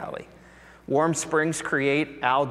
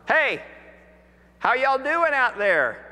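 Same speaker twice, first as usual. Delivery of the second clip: a friendly call, much higher, drawn out across the water; calling from shore; 9 semitones higher than usual. Retold or imitated. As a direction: hail the boat from the beach, slow and high. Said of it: "this voice from the shoreline".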